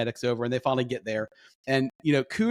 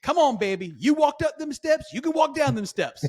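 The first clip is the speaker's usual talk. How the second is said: sassy lady; 12 semitones higher than usual. Retold or imitated. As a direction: imitate a sassy lady coaxing, pitched way up. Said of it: "sassy lady goes".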